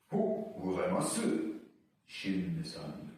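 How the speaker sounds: hammed-up mock Japanese, slow and loud. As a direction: play a cartoonish Japanese speaker. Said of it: "Sound Japanese".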